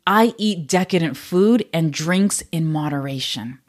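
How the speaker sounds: slow, deliberate affirmation; slow mantra recital. Shown as a demonstration. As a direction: read the mantra slowly to podcast listeners, calm. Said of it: "Another mantra could be".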